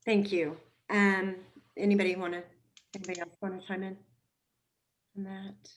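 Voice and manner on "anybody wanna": usual speaking voice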